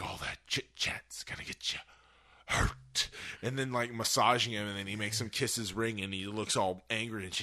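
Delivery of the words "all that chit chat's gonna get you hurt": imitating Joker's hushed threat; menacing whisper. Fan imitating the Joker, whispered menace. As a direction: breathe the threat out low and close.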